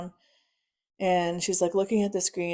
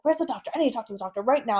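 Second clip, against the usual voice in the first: imitating an urgent relative, higher and rushed; frantic demand; 5 semitones higher, 3 dB louder, much faster than usual. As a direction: demand it in a rapid, agitated rush.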